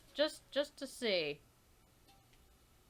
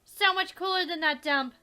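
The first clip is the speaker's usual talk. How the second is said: put-on high, loud excited kid voice; twin character voice; 11 semitones higher, 13 dB louder than usual. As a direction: chirp it like an eager twin. Twins voiced reading game text.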